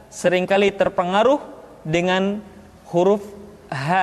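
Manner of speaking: ordinary talk, nothing marked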